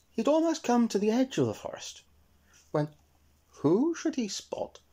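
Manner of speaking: plain reading voice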